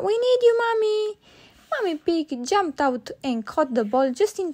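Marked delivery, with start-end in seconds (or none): 0.1-1.2 high, fast, loud cry for help; urgent shout. Acted. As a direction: call for help quickly. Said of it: "shouted Peppa".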